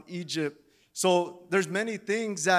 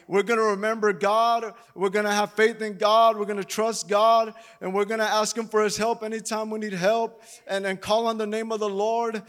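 rousing preaching; 3 semitones higher, 3 dB louder than usual. Acted. Preaching with rising force.

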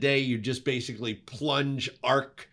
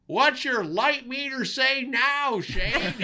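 mock yell; 12 semitones higher, slower than usual. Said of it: "my key grip yells out".

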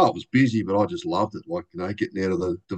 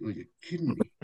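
much softer, incredulous; stunned disbelief; 8 dB quieter than usual. Retold or imitated. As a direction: gasp it out in quiet disbelief. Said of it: "And I went".

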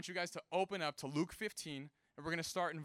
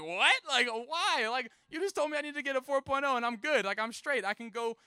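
put-on loud, high-pitched teenage disbelief; indignant protest; 7 semitones higher, 6 dB louder than usual. Son objects to dad's job demand, indignant. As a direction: protest in mock outrage, pitch up.

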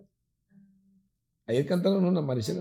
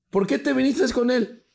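high, slow mock demand; interrogating sister; 12 semitones higher, 4 dB louder, slower than usual. Sister grills girlfriend about coming along, demanding. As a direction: imitate a sister pressing for an answer, drawn out.

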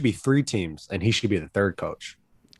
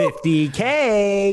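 hype callout; 10 semitones higher, 3 dB louder, much slower than usual. Pretend. Hype callout over fight news.

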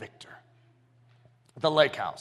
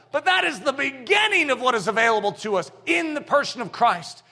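louder, higher, building preacher's cry; preaching shout; 6 semitones higher, 4 dB louder than usual. Real, not acted. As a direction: drive the point home to the congregation.

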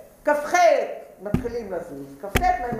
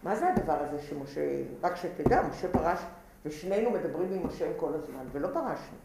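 hushed, softer complaint to her brother; Miriam confiding quietly; 7 semitones lower, 7 dB quieter than usual. Retold or imitated. Miriam tells Aaron quietly, a hushed complaint. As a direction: keep it low, like a private grievance. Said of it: "ואומרת לאהרון".

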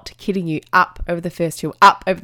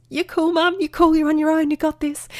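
much higher, put-on little voice; high-pitched reassurance; 10 semitones higher than usual. Parent at the hill talking up confidence, high-pitched reassurance. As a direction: pitch it high and keep it bouncing.

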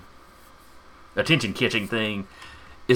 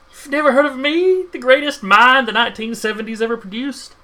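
higher, loud indignant madman; ranting mad professor; 13 semitones higher, 6 dB louder than usual. Acted. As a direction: rave like an offended mad scientist. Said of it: "ranting and raving like a madman".

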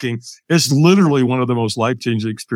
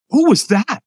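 baffled; 8 semitones higher than usual. Retold or imitated. Baffled after stranger vanishes.

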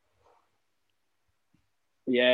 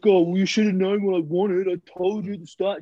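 whiny customer; 6 semitones higher than usual. Retold or imitated. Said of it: "He's like".

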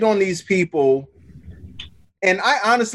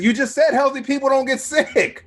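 higher-pitched, exasperated retort; acting out disbelief in an argument; retold incredulous protest; 6 semitones higher than usual. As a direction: throw the contradiction back at him, pitch up. Retold argument, catching out the driver.

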